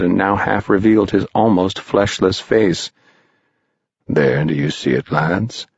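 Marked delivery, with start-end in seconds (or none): 4.1-5.7 Mister Mound Shroud. A narrator's reading.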